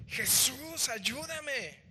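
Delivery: high, loud plea of a desperate father; desperate cry. Acted. Father begging Jesús to heal his son, crying out high and loud. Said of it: "Y entonces grita y dice".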